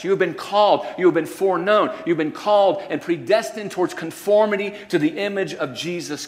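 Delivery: loud, high preaching with punch; full-force preaching. Acted. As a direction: proclaim it to the back row. Preaching builds up.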